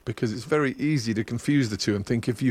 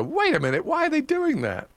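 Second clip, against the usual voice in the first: mock disbelief. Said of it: "and then I go".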